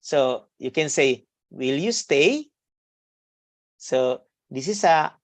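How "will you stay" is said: "Will you stay" slow, climbing on STAY; rising intonation. Shown as a demonstration. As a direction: lift the pitch at the end of "Will you stay". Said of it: "a rising intonation at the end".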